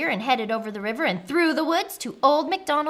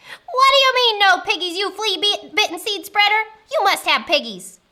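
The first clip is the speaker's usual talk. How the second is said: high, loud, outraged screech; shrieking witch tantrum; furious witch; 9 semitones higher, 8 dB louder than usual. Acted. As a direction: rage in disbelief at the farmer. Witch rages at the farmer.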